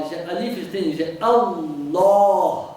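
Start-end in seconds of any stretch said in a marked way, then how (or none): 1.2-2.7 slow, louder "Allah" with a heavy l and a dark vowel; heavy hollow Allah. Shown as a demonstration. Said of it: "hollow and heavy".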